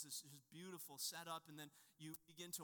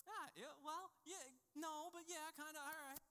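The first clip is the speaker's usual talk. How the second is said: much higher, awkward hemming; flustered dad; 10 semitones higher than usual. Retold or imitated. Dad unsure how to answer his daughter, flustered. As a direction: stammer around a child's question.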